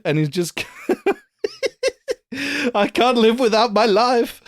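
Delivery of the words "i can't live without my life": high, loud hammed-up wail; mock tragic cry, soaring up on the last word. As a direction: wail it in a hammy high octave. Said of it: "channeling that [name] octave".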